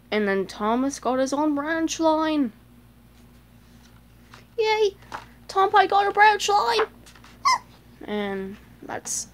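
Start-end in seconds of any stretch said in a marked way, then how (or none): 4.6-6.9 high, loud happy cheer. Real, not acted. Child cheering that Thomas got his branch line, high excited. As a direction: celebrate with delight.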